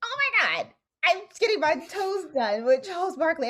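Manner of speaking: giddy starstruck squeal; hyped texting family. Retold or imitated. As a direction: burst with the news to your family. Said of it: "And I'm just so hyped".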